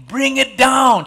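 loud, high preacher's shout; shouted command. Acted. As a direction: call it out to the whole congregation. God's command, shouted to congregation.

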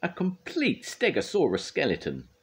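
high-pitched proud announcement; high, impressed curator. A narrator's reading. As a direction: present it with awe and pride. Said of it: "he said proudly".

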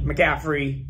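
normal talking voice

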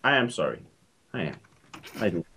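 very low, hushed apology; mock-solemn apology. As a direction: apologize with exaggerated solemnity, dropping way down. Solemn apology after being scolded.

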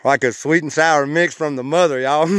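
loud, delighted exclamation; real excitement. Real, not acted. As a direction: burst out thrilled by the taste. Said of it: "I'm sorry to get so excited".

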